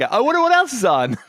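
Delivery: high, loud mock-breezy deflection; fake casual. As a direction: pretend nothing awkward is happening, brightly. Feigning nonchalance during awkward scene.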